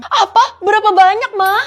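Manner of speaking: high, loud alarmed cry; shocked outcry. Acted. Kid yelling at mum over stolen money, shocked. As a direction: blurt it out in alarm.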